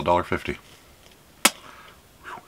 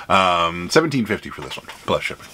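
ordinary talk, nothing marked

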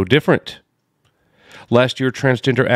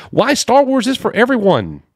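high-pitched mock headline voice; put-on high, sing-out delivery; mocking read-out; 14 semitones higher than usual. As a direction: read it way up high, poking fun. Mock-reads the article's headline.

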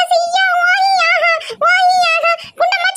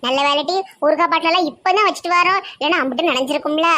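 put-on much lower, grumbling old auntie; low old village woman; faster than usual. Old auntie frets to her sister about the pickle jars, low gruff voice.

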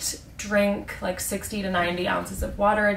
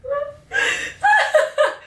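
shrieking burst of laughter; loud high laugh; 5 dB louder than usual. Real, not acted. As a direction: howl with laughter. Cracks up at own word.

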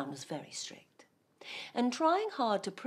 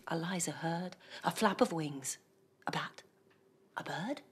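usual speaking voice

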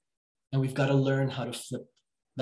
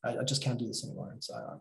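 low, soft, giving-up voice; softer, resigned client voice; defeated client; 3 semitones lower, 5 dB quieter than usual. Retold or imitated. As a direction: sound worn out and beaten, trailing quietly. Client in despair, voiced by coach.

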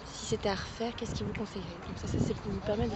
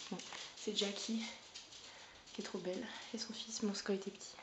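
whisper; 11 dB quieter than usual. Real, not acted. Montre une photo intime.

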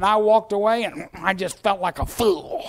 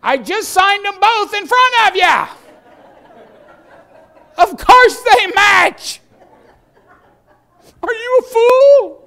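high, loud outburst of disbelief; incredulous full-voiced holler; exasperated shouting; 12 dB louder than usual. Exasperated outburst at the store clerk.

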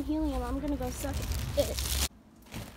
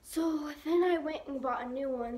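ordinary talk, nothing marked

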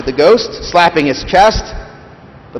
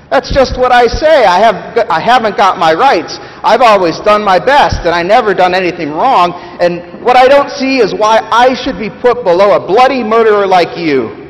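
loud, much higher, protesting; defensive ghost; 8 semitones higher, 6 dB louder than usual. Acted. Big Ghost protesting to the Spirit, loud and defensive. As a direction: bristle and insist you're not arguing.

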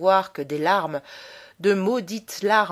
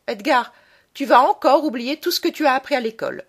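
higher, louder narrated motherly reproach; scolding mother; 5 semitones higher, 6 dB louder than usual. A narrator's reading. Mother sends her son back to his lessons, scolding. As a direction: scold a child in a raised voice.

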